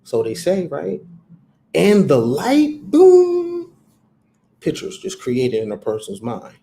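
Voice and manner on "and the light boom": slow and higher, then a big loud boom; drawn-out light, then explosive boom; grand slow proclamation. Shown as a demonstration. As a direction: land the claim with a bang. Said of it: "You have to talk like that".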